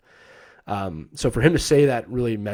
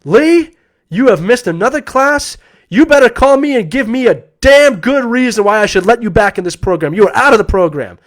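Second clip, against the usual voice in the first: furious professor yelling; 10 semitones higher, 11 dB louder than usual. Retold or imitated. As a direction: bark it at the student like a furious professor. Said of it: "I can still hear his voice to this day".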